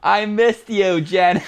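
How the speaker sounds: loud, high Southern movie-simpleton impression; drunken Gump, right up in your face; Forrest Gump. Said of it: "start talking like Forrest Gump".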